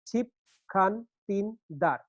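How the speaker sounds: "ছিপ খান তিন দার" slowly, four separate beats; syllable by syllable. Shown as a demonstration. Teacher showing one four-beat foot, syllable by syllable. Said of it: "একটা পর্ব".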